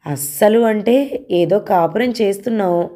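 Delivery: plain reading voice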